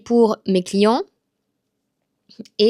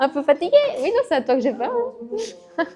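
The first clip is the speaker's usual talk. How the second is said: high playful teasing; 7 semitones higher than usual. Real, not acted. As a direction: tease the sleepy student. Teasing tired student, high voice.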